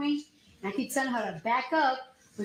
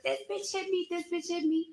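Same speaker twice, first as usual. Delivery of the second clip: much higher, mock-frantic accusing voice; mock receptionist yelling; 6 semitones higher than usual. Mimicking the receptionist's accusation, high mock shriek. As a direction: imitate a receptionist freaking out, pitched way up. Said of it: "she just started wilding out".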